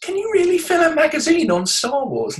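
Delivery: high, doubting voice, lightly played; mock incredulous asker. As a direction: ask it in high disbelief. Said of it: "They say".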